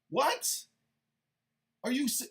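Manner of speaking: normal talking voice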